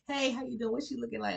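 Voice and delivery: high, sing-song flirty hey, how you doing. Retold or imitated.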